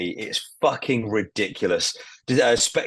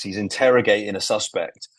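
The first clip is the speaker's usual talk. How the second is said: normal talking voice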